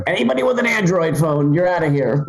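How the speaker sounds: high, declaring impression; Donald Trump. Announcing crazy deportation rules, as Trump. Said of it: "he's just like".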